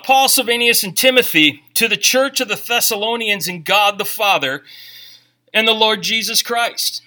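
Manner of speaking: loud, measured Bible reading from the pulpit; scripture reading. Acted. Pastor reading the opening greeting to the church, loud and measured. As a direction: proclaim the verses to the room.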